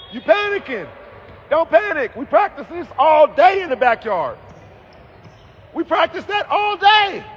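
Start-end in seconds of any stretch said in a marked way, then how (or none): 5.7-7.2 high pleading yell; a high shout from the stands; urgent shout. Real, not acted. Urging panicking player.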